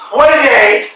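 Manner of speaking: high, loud outburst; loud exclamation. Acted. Estragon exclaims after nearly falling, loudly. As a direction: cry it out, exasperated.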